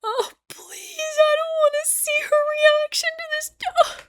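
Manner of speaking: crying while reacting, voice breaking. Real, not acted. Reacting to the show's sad scene, real high-pitched crying. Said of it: "I've just been crying".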